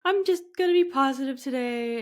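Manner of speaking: high, fake-bright voice pushing sadness away; forced cheerfulness. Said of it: "And you're like".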